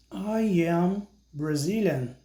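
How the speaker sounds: slow, clear "I am Brazilian" for pupils to repeat; slow model sentence. Shown as a demonstration. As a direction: say each word of "I am Brazilian" slowly so they can copy. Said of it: "Então, repita comigo". (devagar e claro, para o aluno repetir)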